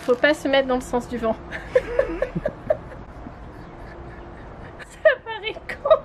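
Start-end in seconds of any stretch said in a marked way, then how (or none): 1.4-4.8 high, breathless fit of laughter; helpless laughter. Real, not acted. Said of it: "ils sont morts de rire". 4.9-6.0 high, squeaky words through tears of laughter; laughing hard. Real, not acted. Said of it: "ils sont morts de rire".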